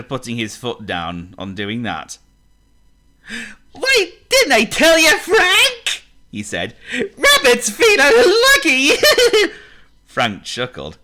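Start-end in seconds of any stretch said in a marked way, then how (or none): 3.7-6.0 very high, loud cartoon rabbit; squeaky rabbit voice. Warren the rabbit teasing Frank, high cartoon squeak. 6.9-10.0 very high, slow, giggling through it; laughing rabbit voice. Acted. Rabbit laughing at his own joke, high giggle.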